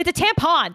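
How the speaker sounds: "tampon" with a full, exaggerated -PON; corrected O vowel. Daughter correcting mum, "tampon" ending in a clear -pon. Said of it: "That's an O. It's not an I".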